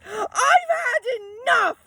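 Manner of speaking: very high, strained, close to tears; screechy shout. Acted. Mum at the end of her tether, a tearful screech. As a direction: screech it out at breaking point. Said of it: "she shouted in a really weird, screechy voice".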